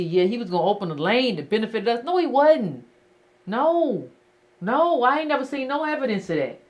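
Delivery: usual speaking voice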